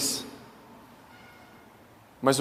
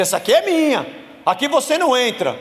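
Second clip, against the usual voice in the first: possessive landowner; 10 semitones higher, 5 dB louder, faster than usual. Retold or imitated. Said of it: "Alguém passou uma cerca e disse".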